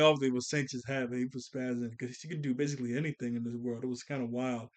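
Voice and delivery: ordinary talk, nothing marked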